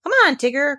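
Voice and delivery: put-on high, loud child call; excited call. A narrator's reading. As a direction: cry out eagerly. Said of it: "cried Roo".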